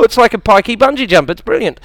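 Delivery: ordinary talk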